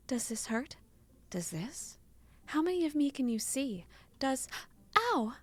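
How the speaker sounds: light caring woman's voice, then a sudden ow; lightly voiced Annette. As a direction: check him for injuries, half worried.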